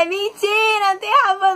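high, teasing mock yell; grito de brincadeira. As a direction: shout it as a prank false alarm. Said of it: "e falar". (grito zoando, de brincadeira)

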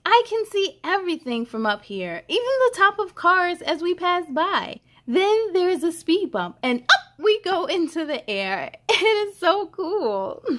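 put-on bright, lively read-aloud for kids; cheerful storybook reading; story narration. A narrator's reading. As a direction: read it like a picture book to little ones. Reads picture book aloud.

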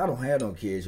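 usual speaking voice

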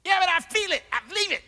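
high whiny protest; high mock whine; whiny fool. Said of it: "Every way of a man is right".

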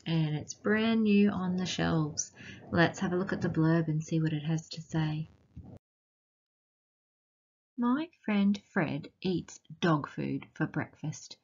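ordinary delivery, nothing marked

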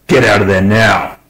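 loud, sharp command; snapped order. A narrator's reading. Captain ordering Smith off the ship, snapping. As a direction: bite the order off hard. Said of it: "Miller snapped".

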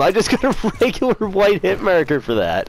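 high-pitched, incredulous protest; laughing disbelief. Real, not acted. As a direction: protest in amazement, breaking into a laugh. Repeats disbelief at failed kill.

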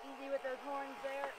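soft, low calming voice; soothing. Real, not acted. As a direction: gently settle a nervous animal. Calming a sheep.

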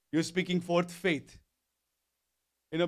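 ordinary delivery

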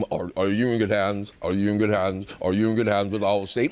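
lightly voiced commercial pitch; low reassuring ad voice; Allstate guy impression. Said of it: "the Allstate guy".

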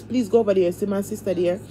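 ordinary talk, nothing marked

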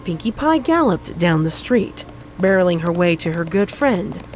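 ordinary talk, nothing marked

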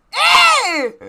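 sudden high squeal of surprise, loud. Real, not acted. Reacting to a meme photo of a bride, a high squeal. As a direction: squeak out a shocked "eh".

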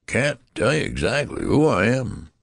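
put-on high, wavering old man's whine; querulous whine. A narrator's reading. Old man answering his frantic father, querulous whine. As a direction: whine it in swooping, querulous waves. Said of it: "replied the querulous whine".

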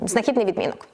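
usual speaking voice

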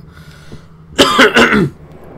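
loud, high squeaky throat clearing. Acted. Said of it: "such a high octave".

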